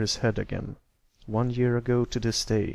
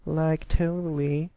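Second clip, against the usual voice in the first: high, slow, snooty drawl; a bratty rich girl agreeing; snobby valley girl; 6 semitones higher, 4 dB louder than usual. Acted. Silver Spoon's voice.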